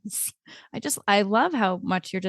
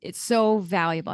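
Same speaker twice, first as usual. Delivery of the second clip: ordinary talk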